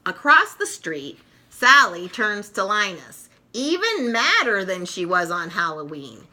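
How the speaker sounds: ordinary delivery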